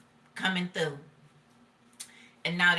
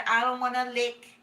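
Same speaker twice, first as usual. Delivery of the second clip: imitating mama; 4 semitones higher than usual. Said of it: "and mama said".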